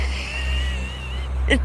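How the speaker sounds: a sudden high-pitched squeal of a laugh; high squeaky laugh. Real, not acted. Vlogger laughing at a commenter's wrong guess, high squeal of laughter. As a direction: burst into a high giggle.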